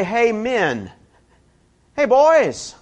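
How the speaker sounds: put-on high, loud jokey shout of hey, boys; mock hearty call. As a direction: hail the guys like old pals, hammed up. Mock hearty greeting to fishermen.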